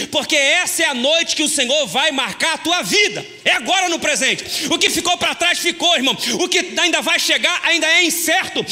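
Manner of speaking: loud, high, driving preacher's exhortation; preaching at full force. Acted. Pastor rallying the church, preaching loud and high. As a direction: pump the congregation up for the blessing.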